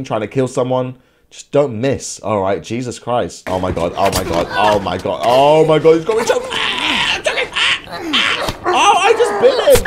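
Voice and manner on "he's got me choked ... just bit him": high, half-laughing choking cries; laughing panic. Real, not acted. Enemy chokes him during the fight, laughing panic. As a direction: squeal and laugh while struggling free.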